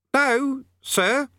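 put-on high, innocent little boy; meek boy. A narrator's reading. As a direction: play the wide-eyed innocent. Eric acts meek to sergeant.

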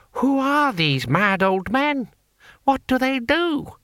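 high, very slow, incredulous questions; appalled bewilderment. A narrator's reading. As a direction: ask in drawn-out, high-pitched dismay. Horrified taxman asks about old men.

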